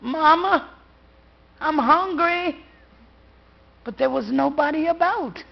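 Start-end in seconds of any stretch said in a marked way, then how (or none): 0.0-2.6 put-on high, small child's plea; child calling mama. A little girl calling to her mama, high and plaintive.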